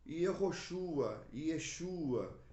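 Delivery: Yehoshua drawn out slowly, then Yeshua; Hebrew names. Shown as a demonstration. Teacher listing original forms of Jesus, Hebrew names. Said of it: "por exemplo".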